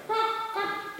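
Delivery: very high cock-a-doodle crow; high-pitched crowing; cockerel crow. Acted. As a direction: crow like a storybook rooster. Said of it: "in her biggest, loudest voice".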